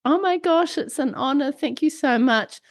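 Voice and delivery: high, excited and thrilled; excited gushing. Retold or imitated. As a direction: gush with delight, pitched high. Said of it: "you got back to me and said".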